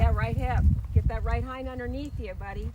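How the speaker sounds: loud called-out instruction; brisk projected riding commands. Real, not acted. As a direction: project across the arena. Instructor calling out to rider.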